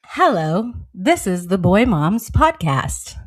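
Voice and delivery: slow, measured recorded greeting; deliberate machine-like announcement; answering machine voice. Shown as a demonstration. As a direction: speak like an automated voicemail message. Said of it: "I do like the answering machine voice".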